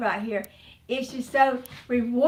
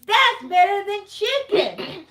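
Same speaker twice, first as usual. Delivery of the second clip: higher, louder, slow and drawn-out son voice; imitating her son; 7 semitones higher, 6 dB louder, slower than usual. Mother voicing her son tasting rabbit, high slow impressed voice. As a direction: play the surprised, won-over son. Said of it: "He says".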